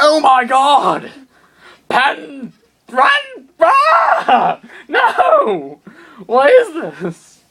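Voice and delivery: terrified shriek as the robot runs at him; panicked shout. Acted. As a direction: shriek like a giant robot is charging you. Giant robot charging at him.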